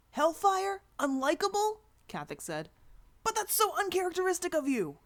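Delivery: high, loud sarcastic disbelief; exaggerated sarcastic gasp; mock surprise. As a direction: pretend to be shocked.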